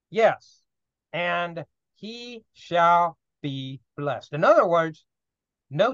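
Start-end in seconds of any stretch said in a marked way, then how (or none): 1.1-4.3 slow solemn. Shown as a demonstration. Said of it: "reading verse slowly".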